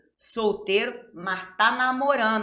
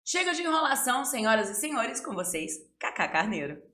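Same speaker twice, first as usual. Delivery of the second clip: grand entrance announcement; 3 semitones higher than usual. Acted. Host introduces guest, showman style.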